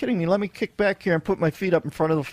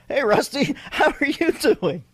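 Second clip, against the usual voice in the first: mock manly greeting, pitched a touch higher; 8 semitones higher than usual. Shown as a demonstration. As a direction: put on a hammed-up man voice. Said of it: "Let me give you my man voice".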